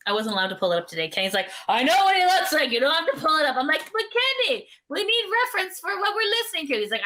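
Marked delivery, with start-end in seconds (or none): 1.7-3.6 loud, high-pitched mock protest; higher, louder retold objection; imitating an exasperated refusal. Acting out Kenny refusing the picture. 3.9-6.7 high-pitched protest, retold; exaggerated begging; mock pleading. Retelling own plea to Kenny.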